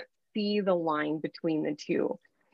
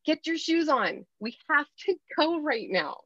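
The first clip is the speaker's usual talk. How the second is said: higher, brisk sharp command; 7 semitones higher than usual. Shown as a demonstration. Mom hurrying her kids out the door, sharp higher voice. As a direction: snap the kids to attention, mildly. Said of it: "sharp tone to your kids".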